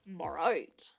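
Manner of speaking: low, playful repeat of BARITE; playful deep echo. Shown as a demonstration. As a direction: drop low on BARITE, sounding it out with a falling end. Echoes the name, relishing its sound.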